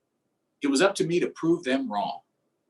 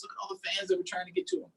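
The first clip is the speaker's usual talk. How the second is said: fast whisper; 5 semitones higher, 7 dB quieter, much faster than usual. Acted.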